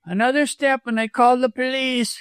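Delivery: high, strained warning, still voiced; frightened stage whisper. A narrator's reading. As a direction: warn in a high, alarmed voice. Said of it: "he whispered".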